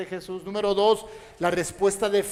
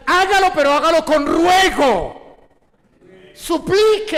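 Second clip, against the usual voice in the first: louder, higher, pressing each line harder; impassioned preaching; 4 semitones higher, 6 dB louder than usual. Acted. Pastor urges the church to pray with pleading, rising fervor.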